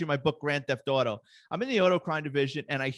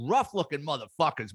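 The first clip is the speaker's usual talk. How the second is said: ordinary talk, nothing marked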